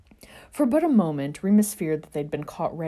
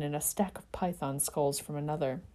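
plain reading voice

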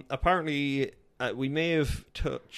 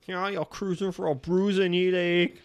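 higher-pitched, hammed-up Geordie accent; attempted Geordie; 8 semitones higher than usual. Shown as a demonstration. Co-host showing how to say it, mock Geordie. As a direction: have a playful go at a Geordie lilt. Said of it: "in Geordie?".

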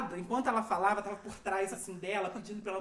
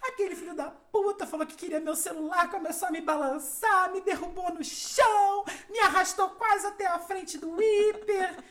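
indignant mother; 8 semitones higher, 3 dB louder than usual. Retold or imitated. Mother telling the police chief about the robbery, hammed-up indignation. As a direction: pile it on, getting louder as she tells it.

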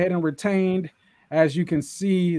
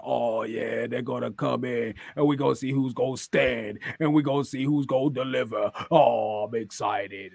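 lower, hammed-up corny Booker T promo; Booker T impression; 5 semitones lower than usual. Booker T hyping Stand and Deliver, low and corny. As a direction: do Booker's corny hype line, lightly. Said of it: "He was like".

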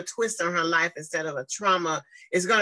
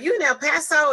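high, louder surprised ask; surprised question; 10 semitones higher, 5 dB louder than usual. Retold or imitated.

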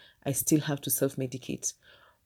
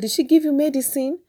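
higher, louder probing question; sharp question; 11 semitones higher, 6 dB louder than usual. Retold or imitated. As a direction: demand an answer from the child. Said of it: "and I asked the child".

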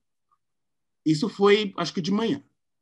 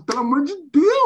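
exasperated; 9 semitones higher, 6 dB louder than usual. Retold or imitated. Scolding the gossip friend.

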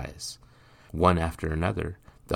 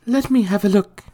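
gentle mother voice; 11 dB louder than usual. Acted.

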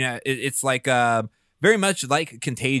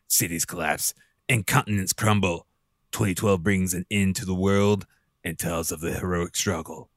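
deep dramatic trailer announcer; movie trailer voice; 6 semitones lower than usual. Shown as a demonstration. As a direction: read it like an epic film trailer. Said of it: "I just wanted to read this in movie Trevor voice".

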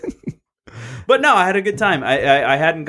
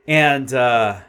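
normal talking voice

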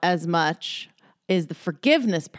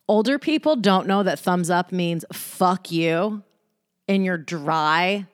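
ordinary talk

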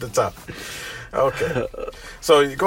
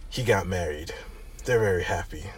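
low whispered tough guy; 8 semitones lower, 12 dB quieter than usual. Acted. Reading Jack's lines aloud.

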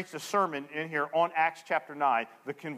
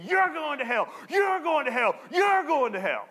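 accusing street preacher; 11 semitones higher, 4 dB louder than usual. Retold or imitated. Street preacher condemning each passerby, high accusing shout. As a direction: mimic a street preacher crying judgment at each person.

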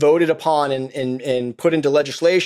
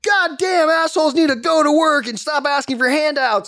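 mocking Facebook ranter; 10 semitones higher than usual. Mocking an angry Facebook poster, high mock outrage. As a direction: sneer it like an angry poster, pitched well up. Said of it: "get on fucking Facebook and be like".

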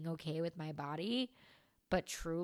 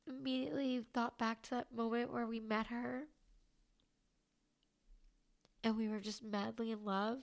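crying; 4 semitones higher than usual. Real, not acted. Retelling husband's loving words about her scar, crying. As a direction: voice breaking high through real tears. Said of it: "I'm officially crying".

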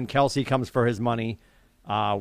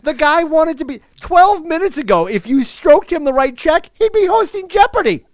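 high, loud, worked-up rant; excited rant; 9 dB louder than usual. Real, not acted. Ranting to his cohost about Brady, worked up. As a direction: rant it, all fired up.

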